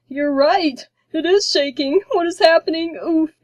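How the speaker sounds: loud, high alarmed cries as the throne shakes; a wobbling king yelling in fright; panicked king. A narrator's reading. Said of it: "The king wobbled on the sphere".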